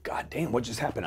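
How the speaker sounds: hushed, unvoiced shocked whisper; breathless whispered shock at the bang; stunned whisper. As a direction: whisper it in disbelief. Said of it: "And I'm like".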